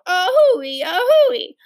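high train-whistle wail with feeling; high, swooping child's whistle imitation. A narrator's reading. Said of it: "and wailed".

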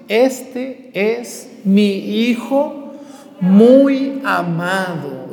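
very slow, drawn-out words; said slowly. Shown as a demonstration. Priest showing the congregation how to say it, very slowly. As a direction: stretch each word of "este es mi hijo muy amado". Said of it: "díganlo despacio".

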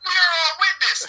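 hammed-up superstar proclamation; LeBron James impression. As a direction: announce it like a star athlete, lightly voiced. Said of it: "Like, I'm LeBron James".

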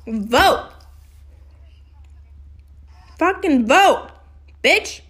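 loud, punchy exhortation to vote; forceful command. Real, not acted. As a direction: order the listeners, voice jumping up.

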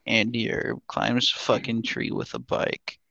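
slow, soft, dull talking vegetable; tired potato voice. Retold or imitated. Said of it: "his tired fucking potato voice".

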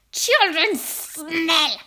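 loud, high, very drawn-out witch cry; screamed disgust. A narrator's reading. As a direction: stretch it out with loud revulsion. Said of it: "she screamed".

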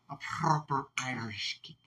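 breathy whispered mock Irish line. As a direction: whisper it like a menacing aside.

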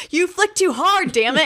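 lightly hammed-up Thor complaining. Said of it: "Thor's like".

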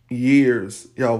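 ordinary delivery

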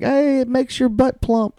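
high, slow put-on pitchman voice; high slow hammed-up ad read; mock ad voice. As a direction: sell it like a cheesy commercial. Mock ad voice opening.

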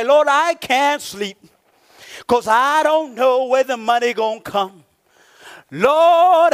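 long, loud wailed "Lord", then pleading; acted sleepless prayer, crying out without tears; crying out to God. Preacher models a sleepless believer's prayer, crying out. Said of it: "That is the time to cry".

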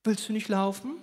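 put-on high, soft coaxing voice to a crying toddler; sweet neighbor. Neighbor coaxes the crying two-year-old, high sugary coo. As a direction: coo and tempt the little boy. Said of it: "sieht den weinenden Jungen und sagt".

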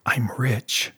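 secretive whisper; castaway confides his treasure in a whisper. Acted. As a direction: breathe it like a secret. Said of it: "lowering his voice to a whisper".

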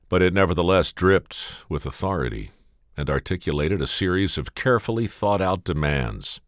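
ordinary delivery, nothing marked

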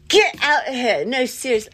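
rattled, insistent; alarmed shooing. Real, not acted. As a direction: shoo it off in a fluster. Shooing the spider away.

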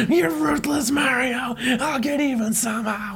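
high wailing cartoon Wario; Wario crying out. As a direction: wail the threat up high. Wario voiced in comic retelling.

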